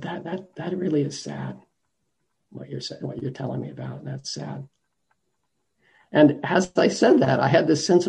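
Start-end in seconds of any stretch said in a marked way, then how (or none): 0.0-4.7 much softer, gently consoling his mother; tender, quiet comfort. Retold or imitated. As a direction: say it softly, like comforting someone crying.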